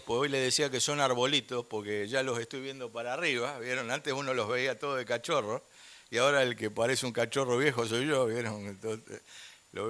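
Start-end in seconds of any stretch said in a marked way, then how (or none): none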